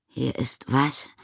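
high, slow, uninterested question; vague, drawn-out query. A narrator's reading. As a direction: ask slowly, without much interest. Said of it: "fragte Amelie ohne Interesse".

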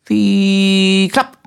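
high drawn-out whistle-like whine, then a clap; imitated door noise. A narrator's reading. Simpel copying the metro doors, playful sound imitation. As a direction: mimic metro doors, long rising tone then snap. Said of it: "imitierte halblaut das Geräusch der Metrotüren".

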